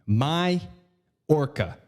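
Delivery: "Majorca" slowly split as "my... orca"; broken into two words. Shown as a demonstration. Comedian teaching the island's name, split in two. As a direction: pause midway through "Majorca", sounding like "my orca". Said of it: "That's how you pronounce it".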